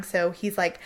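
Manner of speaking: ordinary talk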